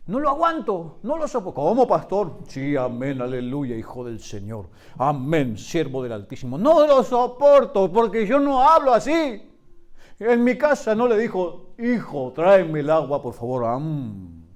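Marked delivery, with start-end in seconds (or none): 1.6-6.6 lightly voiced, lower pious churchy tone; a hint of a sanctimonious church voice; mock super-spiritual talk. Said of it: "me habla mega archi espiritual". 6.6-11.7 full-force preaching, stretching the key lines; fiery preaching. Acted. 11.8-14.4 lower, syrupy holy tone asking for water; a light imitation of an over-spiritual parent; mock pious home talk. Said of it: "No hago así".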